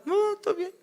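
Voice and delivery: high, clipped mock wife voice; wife brushing off her husband, high and curt; sulky wife denial. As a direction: play the wife insisting she's fine. Wife denies being angry.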